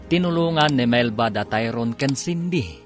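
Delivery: normal talking voice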